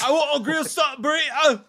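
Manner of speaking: put-on high, loud Scottish accent; hammy Scottish accent. Continuing Scottish accent bit.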